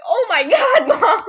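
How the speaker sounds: high, loud, half-laughing groan at a parent; laughing exasperation. Retold or imitated. Daughter scolding her mom over the slip, laughing exasperation. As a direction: cry out, exasperated but amused.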